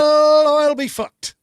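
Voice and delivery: high, loud hammed-up Irish accent. Host shows off his Irish accent, hammed-up. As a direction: belt it out like a stage Irishman. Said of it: "You like my Irish accent there?".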